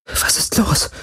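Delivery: whispered, uncertain question; unsure whisper. A narrator's reading. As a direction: whisper it, unsure of wanting the answer. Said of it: "flüsterte sie, spürbar unsicher". (unsicher geflüstert)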